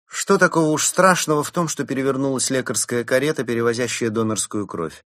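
high-pitched, brisk rhetorical question; scoffing Berta. A narrator's reading. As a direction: start high and loud, then rattle on. Berta scoffs at Mole's fear. (высоко, с недоумением)

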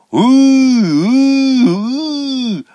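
very high, loud "У, у" hoots; mooing hoot. A narrator's reading. Boy hoots to check for an echo, loud. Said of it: "и закричал".

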